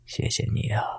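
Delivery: put-on faint, whispered old ghost voice; breathy ghostly old voice. A narrator's reading. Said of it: "传来一声苍老的声音".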